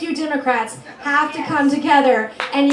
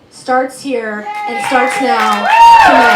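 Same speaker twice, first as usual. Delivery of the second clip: rallying shout; 7 dB louder, slower than usual. Real, not acted. Rally climax cheer line.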